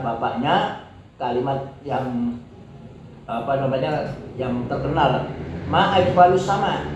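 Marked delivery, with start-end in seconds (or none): 5.7-6.9 MA high, "ajmalus sama" falling; exclamation of wonder. Shown as a demonstration. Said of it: "Ini kan ta'ajjub".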